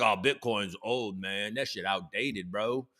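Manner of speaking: low, soft, slow mock dude voice; mocking dismissive bros. Mocking Bitcoin doubters who call it outdated, low dismissive bro voice. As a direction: imitate unimpressed crypto bros, quiet and low. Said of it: "Some of you are out there being like".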